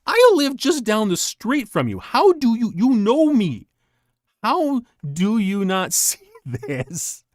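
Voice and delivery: high, loud disbelieving protest; you can't believe they fell for it; incredulous. Retold or imitated. Telling townspeople he lives down the street, incredulous.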